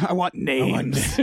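slow, low mock interrogation; imitating Batman. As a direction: stretch it out like a hammed-up vigilante. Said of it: "the way he says".

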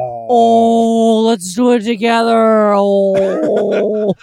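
loud put-on moose call. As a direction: moan it like an amorous moose. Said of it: "Mooses say".

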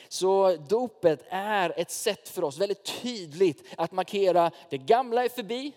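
loud, high, slow preaching push; preaching at full force. Acted. Pastor preaching to the church at full force. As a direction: drive the point home to the congregation.

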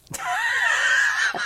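high squealing burst of laughter; shrieking laugh. Real, not acted. Amused by Dawn's business-lady panic, squealing laugh.

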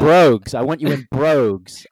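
booming mock command; loud mock demand. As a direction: bark it like a strict doorman. Mock strict dress code demand.

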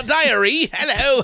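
high, hammed-up British toff voice; mock British accent. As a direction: greet like a pompous British gent. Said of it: "Sorry, all my English friends".

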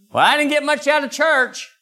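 high, whiny complaint; mock the lazy churchgoer; squeaky put-upon whine; whiny excuse.

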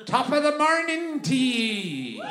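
high, slow, hammed-up Irish accent; mock Irish accent. Shown as a demonstration. Comic showing Irish crowd his practised accent, hammed-up Irish. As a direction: belt out a drawn-out parody Irish accent. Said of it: "I've been practicing the accent".

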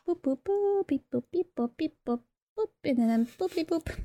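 playful robot boops and beeps; little droid noises sung out in uneven pitches; R2-D2 beeping. Pretend. Filling time for the players, R2-D2 beeps. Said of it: "We're fighting R two D two".

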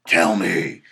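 low, loud imitated demand; Joker impression. Joker yelling on TV in the jittery footage, mock-menacing shout. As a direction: bark it out in a deep mock-menacing voice. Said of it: "He's like".

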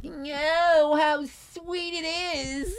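very high, drawn-out Gleason catchphrase; Jackie Gleason impression. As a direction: belt it out like a showman celebrating a sale. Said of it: "this Jackie Gleason thing".

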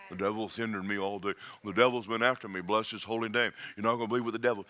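low, soft mock complaining about the devil; whiny believer. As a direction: mimic a defeated churchgoer moaning.

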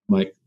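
sharp high gasp of alarm; shocked gasp. Retold or imitated. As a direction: gasp like someone scandalized.

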